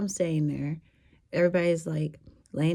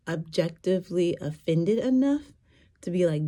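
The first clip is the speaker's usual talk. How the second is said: ordinary talk, nothing marked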